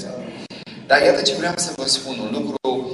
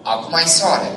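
usual speaking voice

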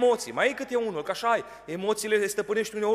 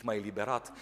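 ordinary delivery

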